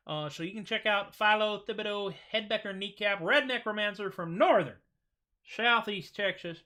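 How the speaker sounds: hint of a country Texas accent for a character. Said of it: "I love talking in that accent".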